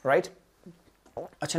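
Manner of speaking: ordinary talk, nothing marked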